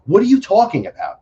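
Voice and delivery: loud, high retold disbelief; incredulous outburst. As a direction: throw it back loud and high, stunned. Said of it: "So I go".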